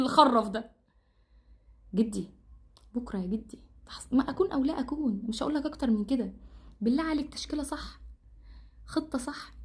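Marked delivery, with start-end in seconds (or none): 1.9-9.5 softer, pleading; quiet plea. Real, not acted. Fan softly pleading with coach for the right lineup, quiet. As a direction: beg him softly.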